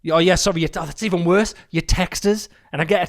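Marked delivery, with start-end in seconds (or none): none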